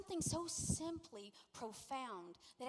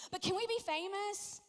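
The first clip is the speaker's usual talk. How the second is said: higher-pitched mock plea for fame; lightly acted eager follower, pitched up; eager disciples; 7 semitones higher, 5 dB louder than usual. Disciples begging Jesus for glory, mock-eager.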